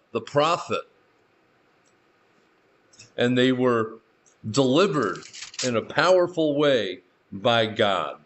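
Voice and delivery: usual speaking voice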